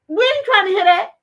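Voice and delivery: hammed-up fans talking back to the actress; mocking angry fans. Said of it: "people like".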